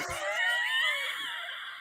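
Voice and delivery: high squealing laugh. Real, not acted. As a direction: burst into a shrieking laugh. Big laugh after crude joke.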